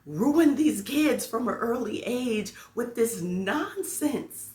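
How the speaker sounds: high, slow, emphatic complaint; retold indignation. Teacher complaining parents ruined these kids, indignant. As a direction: stretch out the exasperated accusation. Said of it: "I got angrier than I should".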